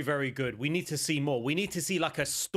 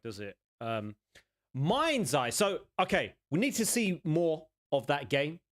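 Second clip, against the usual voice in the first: usual speaking voice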